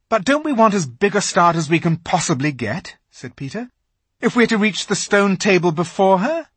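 pressing the point; urgent Peter. A narrator's reading. Peter urges haste.

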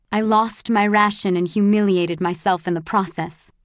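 ordinary delivery, nothing marked

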